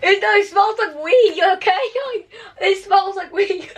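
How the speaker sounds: high, laughing disgust. Real, not acted. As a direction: giggle out the words. Laughing at smell.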